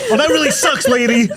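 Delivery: high put-on voice breaking into laughter; mock guy, laughing. Mocking the guy again, high and laughing through it. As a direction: sneer it again while laughing. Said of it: "He goes".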